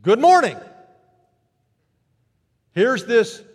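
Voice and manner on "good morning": bright high loud good morning; cheerful greeting. Acted. Jesus greeting the women, loud and cheerful. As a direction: beam as you greet them. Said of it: "says cheerfully".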